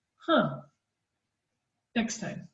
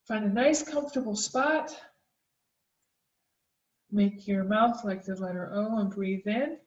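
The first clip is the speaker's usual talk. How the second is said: guided breathing; 4 dB quieter than usual. Acted. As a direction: calmly lead the breathing exercise. Leads a snake breath mindfulness exercise.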